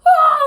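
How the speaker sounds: sudden high loud yelp of a scream; mimicking a dad screaming from the next room; imitated dad's scream. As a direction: belt out a short shocked scream. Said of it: "scream from the living room".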